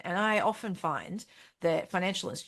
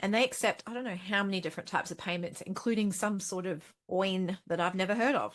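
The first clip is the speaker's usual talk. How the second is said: ordinary delivery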